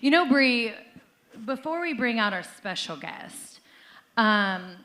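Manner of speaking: normal talking voice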